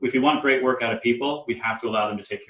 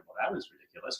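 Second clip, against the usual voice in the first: whispered self-dismissal; 11 dB quieter, faster than usual. Retold or imitated. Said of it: "it's just like".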